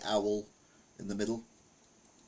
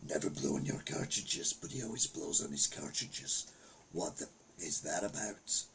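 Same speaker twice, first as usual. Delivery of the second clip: lower, softer conspiratorial whisper; 5 semitones lower, 6 dB quieter than usual. Acted. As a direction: whisper it like a secret aside. Whispered repeat as secret aside.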